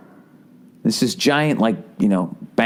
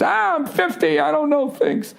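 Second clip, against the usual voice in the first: much higher, put-on doddering old-timer voice; mock old man. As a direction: play a clueless old guy making excuses. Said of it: "this is when you start to have excuses".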